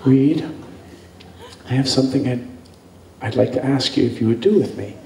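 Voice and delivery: low, soft coy request; hushed, coy milkmaid; shy milkmaid. Retold or imitated. Milkmaid voiced shyly.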